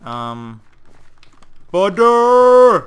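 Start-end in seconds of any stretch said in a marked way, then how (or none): 1.7-2.9 loud, high drawn-out word; excited announcement. Real, not acted. Player announcing the next parkour map to viewers, excited. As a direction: sing out the map name.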